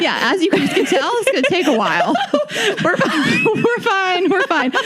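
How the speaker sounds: high, breathless laughter over the words; laughing through tears. Real, not acted. Co-host tells listeners they're fine, real laughing through tears. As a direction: laugh it off while barely holding together.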